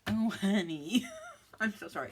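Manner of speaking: loud laughing oh honey; real laugh at the name; laughing again. Real, not acted. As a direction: burst out laughing, then apologize. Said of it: "I shouldn't laugh".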